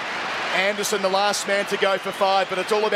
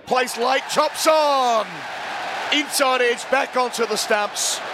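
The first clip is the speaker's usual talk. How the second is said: excited; 5 semitones higher, slower than usual. Real, not acted. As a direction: shout the chop-on. Wicket falls, shouted call.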